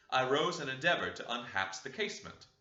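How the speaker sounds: plain reading voice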